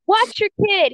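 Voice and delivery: high-pitched mock yell; imitated angry yell. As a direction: call out accusingly, imitating an angry stranger. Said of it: "yells at me".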